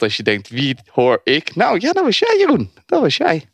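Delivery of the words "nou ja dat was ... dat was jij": teasing. Real, not acted. Teasing guest about sped clip.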